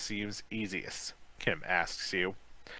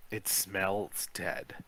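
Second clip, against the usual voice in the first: usual speaking voice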